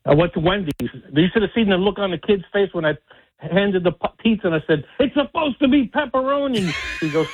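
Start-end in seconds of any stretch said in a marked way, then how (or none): 5.0-6.7 retold rage. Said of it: "So now I'm in a rage".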